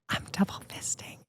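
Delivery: breathy hushed aside; whispering. Real, not acted. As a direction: whisper it to the room. Whispered aside during bottle pop.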